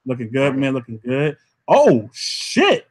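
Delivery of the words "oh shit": a real high jolt of surprise, higher pitch; surprised. Real, not acted. Host spotting his friend won, surprised. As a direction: caught off guard by good news. Spots friend's tournament win.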